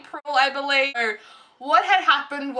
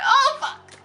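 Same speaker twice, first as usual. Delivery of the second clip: shocked swear; 11 semitones higher than usual. Retold or imitated. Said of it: "And I was like".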